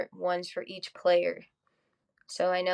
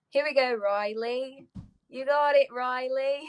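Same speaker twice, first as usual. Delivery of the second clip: high, playful British-style accent; put-on British-sounding accent; 6 semitones higher than usual. Shown as a demonstration. Player cheering teammate from the dugout, mock British accent. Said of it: "talking in a different accent".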